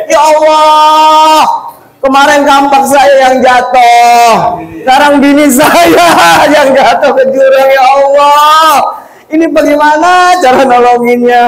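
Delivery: ratapan pura-pura, tinggi dan keras; mock wailing. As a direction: merintih berlebihan seperti orang menangis. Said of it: "Ini nangis lagi orang yang tadi". (meratap dibuat-buat, suara tinggi)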